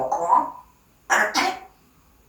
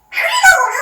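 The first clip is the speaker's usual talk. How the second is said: high pet talk; 7 dB louder, much faster than usual. Real, not acted.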